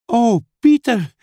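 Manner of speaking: high, loud cry to a brother; exclaiming. Acted. Lucy calling to Pieter after arriving, exclaiming. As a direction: exclaim in wonder. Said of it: "riep Lucy uit".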